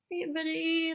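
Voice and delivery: high, cooing voice for a pigeon; sweet talk to a pigeon. Retold or imitated. Greeting a pigeon on the street, in a high cooing voice. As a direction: coo at the bird like a pet. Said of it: "I'm like".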